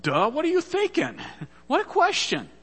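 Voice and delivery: loud, high-pitched sarcastic retort; incredulous, comic outburst; mock exasperation. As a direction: scoff at a silly question, hammed-up. Imagined indignant retort as Mary.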